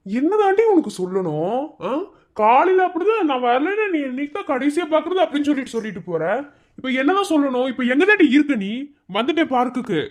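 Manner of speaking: high-pitched, worked-up complaint; exasperated scolding. Acted. (எரிச்சலுடன் குரல் உயர்த்தித் திட்டி)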